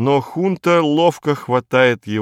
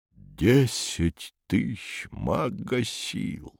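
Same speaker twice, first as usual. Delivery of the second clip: slow, much softer stunned mutter; 8 dB quieter, much slower than usual. A narrator's reading. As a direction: mutter it to yourself in shock. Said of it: "бормочет Фёдор Семёнович".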